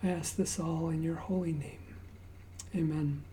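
usual speaking voice

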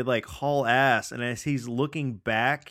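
normal talking voice

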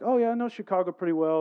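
high, loud, quick burst of chattiness; retold eager reply. Retold eager reply in small talk.

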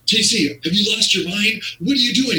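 squeaky high frantic voice of a flustered owner; panicked owner. Retold or imitated. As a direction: play the boss losing his mind in a shrill squeal. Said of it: "This guy's, like, losing it".

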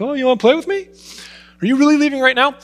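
high, pleading puppy voice, loud; pleading dog voice. Retold or imitated. Pastor voicing his dog begging him not to leave, high and pleading. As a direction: voice the dog begging you to stay and play.

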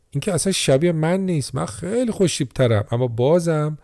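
playful joking Giannis. A narrator's reading. Giannis jokes to reporters.

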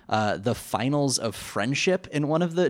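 ordinary talk

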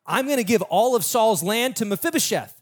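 high, enthusiastic proclamation; acted burst of royal excitement; delighted king. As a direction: announce a generous gift with delight. Acts King David's delighted decree.

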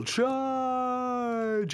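high, drawn-out cry of Charge; mock war leader sending the tribe off, long and high; yelled battle cry.

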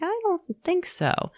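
drawn-out high doubtful scoff; sarcastic disbelief. Retold or imitated. Mom rejects people's reassurance, high sarcastic doubt. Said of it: "I'm like".

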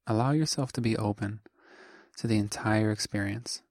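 calm meditation voice, short phrases with long pauses; guided meditation. Acted. Teacher guides listeners into just being, calm meditation voice. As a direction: guide the listener gently, leaving long silences between phrases.

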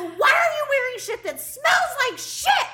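furious at her husband for wearing smelly shirts; angry yell. Retold or imitated. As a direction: demand it at full outrage. Confronting husband over smelly shirts.

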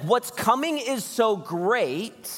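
very slow, drawn-out high emphasis; preacher dwelling on each word. Retold or imitated. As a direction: stretch out every word. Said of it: "He's like".

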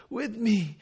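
acted dying exertion; pained effort. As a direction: pull yourself up to get it out. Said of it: "imagine the effort and the pain".

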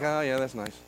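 low, quiet, indifferent reply; barely bother to cheer; uncommitted. Retold or imitated. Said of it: "I was uncommitted".

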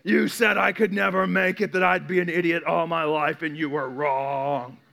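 slow, drawn-out mock grievance; bitter grudge. Author settling scores with an old teacher, mock-bitter. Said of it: "books I've read that was like".

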